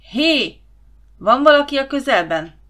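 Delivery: hangos, magas kiáltás; kiálts bele a sötétbe; kiabálás. Acted. (a főnök hangosan kiabál a sötétben)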